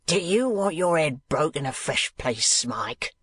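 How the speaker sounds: a touch of a shrill threatening wife; lightly voiced harsh schoolmaster's wife; Mrs Squeers shouting. A narrator's reading. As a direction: bark the threat at the boy. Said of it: "in the same key".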